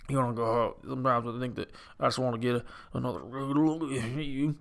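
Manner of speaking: drowsy movie-star mumble; Bradley Cooper grumble. Retold or imitated. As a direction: swallow the words in a thick accent. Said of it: "he's drowning in his own accent".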